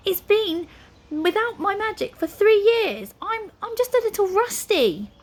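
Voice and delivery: high-pitched, flustered wizard excuse; defensive wizard. A narrator's reading. Wizard excuses his failed magic, defensive.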